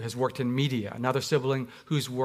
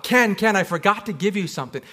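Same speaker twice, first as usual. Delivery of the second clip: high, loud call from the doorway; aunt shouting after the boy down the driveway; 5 semitones higher, 5 dB louder than usual. Retold or imitated. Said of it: "she shouts out".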